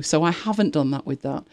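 usual speaking voice

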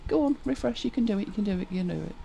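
soft, quick little pep talk; hushed mock encouragement; coaxing under the breath. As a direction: urge it on quietly, fading away. Coaxing the computer along.

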